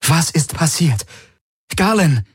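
high, urgent demand; worried woman demanding news, pitched high. A narrator's reading. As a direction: press anxiously for an answer. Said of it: "Ihre Stimme war fordernd und besorgt".

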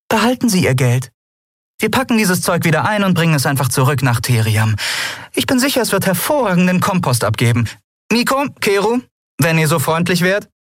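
high-pitched, confident heroine; charming but firm with a swindler; self-assured captain, disarming. Acted. Heroine confronting a businessman.

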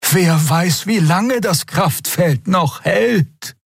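high, slow urgent warning; urging. A narrator's reading. Xea clings to Keru's arm, urging. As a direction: urge them on in a high, drawn-out voice. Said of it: "mahnte Xea".

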